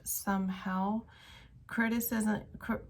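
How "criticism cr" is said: emotional, stumbling with a small laugh; choked up. Real, not acted. Tarot reader apologizing to viewers for hardly speaking, choked up. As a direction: stumble on the word, then laugh it off through emotion. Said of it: "I'm so emotional I can hardly speak".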